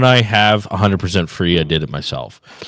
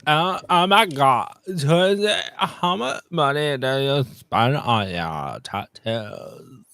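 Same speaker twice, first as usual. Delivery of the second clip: slow, put-on teasing impression; slow accented mimicry, trailing off softly; Yeliana; 3 semitones higher, 3 dB quieter, slower than usual. Reading a viewer's tattoo question aloud, mimicked voice. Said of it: "so I'll do Yeliana voice".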